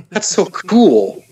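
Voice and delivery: high, slow, wide-eyed that's so cool; a kid thrilled by something new; delighted awe. Retold or imitated. Alien amazed after seeing him snort tea. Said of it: "he looks at me and he says".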